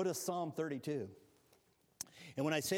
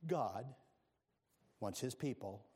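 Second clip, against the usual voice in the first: ordinary talk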